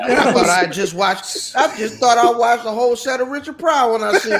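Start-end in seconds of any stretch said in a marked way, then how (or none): none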